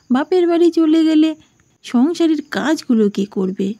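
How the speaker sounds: high, exasperated lament; regretful mother-in-law. Acted. Mother-in-law lamenting her backfired scheme, exasperated.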